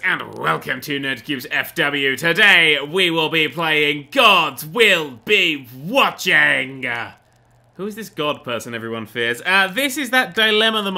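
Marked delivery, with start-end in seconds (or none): 0.0-6.8 loud, slow announcer intro; show intro. Acted. Channel intro greeting.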